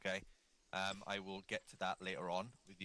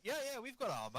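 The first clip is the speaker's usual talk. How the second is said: mock reply; 13 semitones higher than usual. Voicing Irish players.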